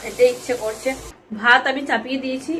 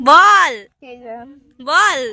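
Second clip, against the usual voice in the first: loud, raised, drawn-out order; insistent demand; 7 dB louder, much slower than usual. Real, not acted. Quizzing a child who is still thinking, loud insistent demand. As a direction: press hard for an answer. Pressing a silent child to answer.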